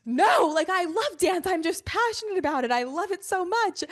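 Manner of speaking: high, gushing devotion; lightly mocked besotted young dancer; gushing about dance. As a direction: gush like a starry-eyed teen dancer. Gushing past self about dance.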